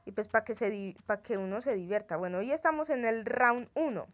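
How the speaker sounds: ordinary talk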